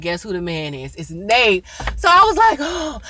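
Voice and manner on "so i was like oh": relieved. Retold or imitated. Relief it's not Nate.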